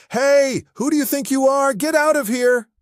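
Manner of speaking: high, loud screechy parrot squawk. Acted. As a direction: screech at the boss like a rude parrot. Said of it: "Polly starts squawking".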